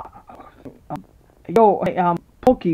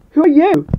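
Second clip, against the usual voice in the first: ordinary delivery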